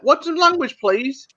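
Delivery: prissy objection. Retold or imitated. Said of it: "They're like".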